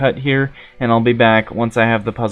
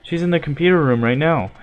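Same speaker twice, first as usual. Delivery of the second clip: ordinary delivery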